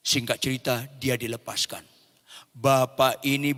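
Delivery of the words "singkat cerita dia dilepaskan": usual speaking voice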